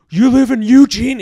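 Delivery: loud, high, incredulous retold question; escalating border guard. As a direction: raise pitch and volume as the mock guard. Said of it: "he's, like, getting older".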